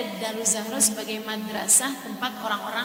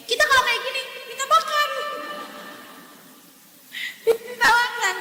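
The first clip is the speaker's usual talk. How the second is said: much higher, louder lively demonstration; animated high demo; 13 semitones higher, 4 dB louder than usual. Shown as a demonstration. Explaining Indonesian hand sign to audience, high and animated. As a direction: show the begging gesture with a high exclaim.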